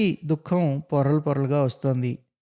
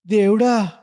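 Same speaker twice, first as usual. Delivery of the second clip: high, loud plaintive cry to God; desperate wail, higher and louder; anguished cry; 6 semitones higher, 5 dB louder than usual. A narrator's reading. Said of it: "అంటూ కాతరంగా".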